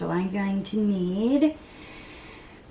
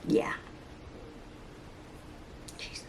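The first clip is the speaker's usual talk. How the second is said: normal talking voice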